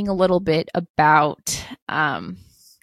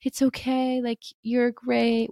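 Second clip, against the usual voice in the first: higher, slow, soothing mother's voice; comforting mother; 6 semitones higher, slower than usual. Retold or imitated. Mother soothing her child home from a bully, higher and slow. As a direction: hold the crying child and reassure them, higher and slow. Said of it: "your mother to hold you and just be like".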